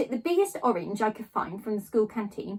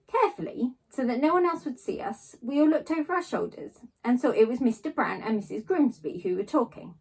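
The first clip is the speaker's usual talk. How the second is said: ordinary delivery, nothing marked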